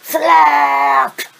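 sudden pained shout. Acted. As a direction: yell it out in pain. Character cursing in pain.

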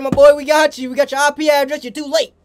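ordinary talk

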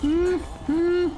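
high panicked whimpers; scared whimpering. Real, not acted. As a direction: whimper high while fleeing. Panicked whimpering while fleeing.

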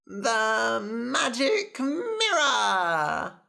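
high, very drawn-out whining demand; demanding little princess. Acted. Princess naming her prize to her father, high stretched whine. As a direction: stretch every word in greedy longing.

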